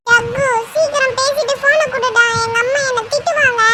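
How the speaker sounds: put-on high little-kid voice; high childish nagging; anxious child. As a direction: plead worriedly like a small child. Child worries about being scolded.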